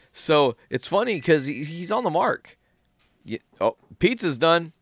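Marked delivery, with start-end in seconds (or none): none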